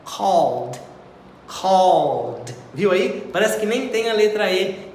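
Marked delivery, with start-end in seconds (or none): 0.0-0.8 Called said with no E sound, ending in LD; Called as one syllable; teacher modelling Called; model pronunciation. Shown as a demonstration. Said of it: "pronúncia do verbo call no passado". 1.5-2.5 Called said with no E sound, ending in LD; Called as one syllable; teacher modelling Called; model pronunciation. Shown as a demonstration. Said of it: "pronúncia do verbo call no passado".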